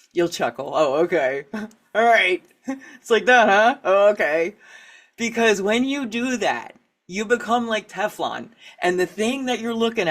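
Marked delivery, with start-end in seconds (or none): 0.7-4.5 laughing, louder "Oh, okay, all right"; chuckling shrug. Shown as a demonstration. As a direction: let it slide off with a laugh. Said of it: "it'll make you laugh".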